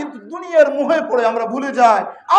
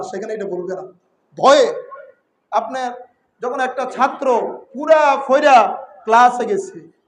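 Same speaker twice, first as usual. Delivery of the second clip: normal talking voice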